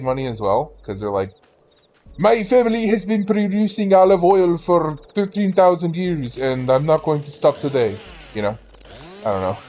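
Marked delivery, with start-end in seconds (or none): 2.2-8.0 lightly put-on Italian old-timer accent; hammy Italian pride voice; mock Italian accent. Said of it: "That's not Italian at all. That's more Russian".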